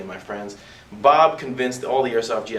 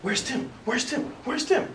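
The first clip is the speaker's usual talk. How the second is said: rising high calls, climbing each time; mocking sing-song; 13 semitones higher, 6 dB quieter, slower than usual. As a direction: taunt them searching for him. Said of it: "They're going".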